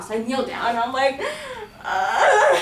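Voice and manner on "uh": high crying wail, hammed up; mock bawling. Speaker kneeling over the dying dog, acted bawling. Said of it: "crying my eyes out".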